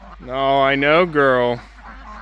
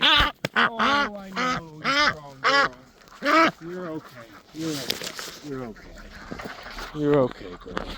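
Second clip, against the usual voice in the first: soft, hushed reassurance to a hurt hen; soothing hush; 8 dB quieter, slower than usual. Real, not acted. Soothing the injured hen while carrying her, hushed and tender. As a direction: murmur it gently, almost under breath.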